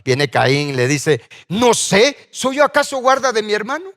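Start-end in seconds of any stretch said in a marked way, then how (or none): 1.5-3.9 higher-pitched, challenging comeback; defiant retort. Acted. Cain answering God about his brother, defiant. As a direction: throw the question back in mock defiance.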